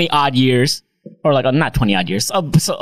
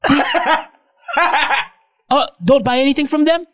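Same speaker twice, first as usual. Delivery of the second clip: higher, chirpy pretend call; fake phone call; 11 semitones higher than usual. Pretending to be picked up at Glorieta, chirpy fake call. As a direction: keep faking the call to his uncle. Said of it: "hold on, I got a call".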